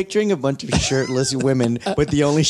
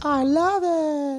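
1 drawn-out delighted customer; much slower than usual. Retold or imitated. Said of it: "She goes".

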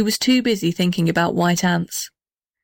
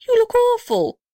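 shocked. Acted. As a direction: gasp it out in horror. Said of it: "he cried".

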